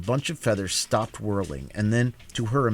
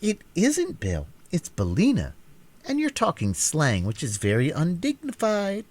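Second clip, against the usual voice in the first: put-on high, prim girl correcting; little girl reproving her hen; 8 semitones higher than usual. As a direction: scold her hen primly. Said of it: "said Dorothy reprovingly".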